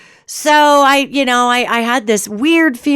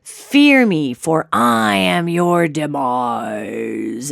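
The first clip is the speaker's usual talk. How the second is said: much lower, very slow demon threat; hissing demon; 8 semitones lower, much slower than usual. Acted. Giant demon looming over the deer, slow low threat. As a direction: drag out the doom like a giant monster. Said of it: "and it hisses".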